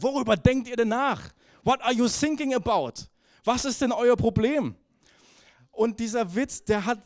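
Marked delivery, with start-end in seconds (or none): 0.0-4.7 louder, higher baffled officer; hammed-up confused radio reply; mock puzzled coast guard. Coast guard misunderstands sinking as thinking, mock puzzled. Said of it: "er versteht nicht wegen T H und sagt".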